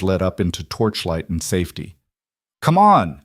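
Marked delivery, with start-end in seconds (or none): 2.6-3.2 high, loud yell. A narrator's reading. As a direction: urge them on at full voice. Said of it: "Mara yelled".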